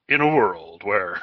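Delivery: low, slow trailer-style voiceover; movie trailer announcer. Pretend. Teasing the newly engaged guest about the proposal trailer, movie trailer voice. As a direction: intone it like a movie trailer opener. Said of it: "start the trailer off with".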